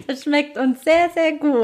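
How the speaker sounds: laughing ironic praise. Real, not acted. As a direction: giggle through fake praise.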